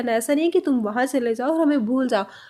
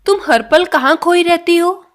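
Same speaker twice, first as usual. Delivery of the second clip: louder model question; 4 semitones higher, 7 dB louder than usual. Shown as a demonstration. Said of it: "next sentence".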